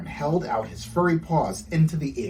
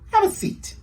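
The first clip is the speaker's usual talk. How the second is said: tiny breathless whispery hamster, much higher; 3 dB louder than usual. Acted. The hamster Popcorn invites Prince to sit, tiny high whisper. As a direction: squeak it out like a tiny pet.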